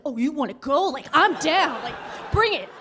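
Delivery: mock-tough, itching for a fight; cocky challenge. Monkey sister squaring up at being laughed at, cocky challenge. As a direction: rise into a cocky dare.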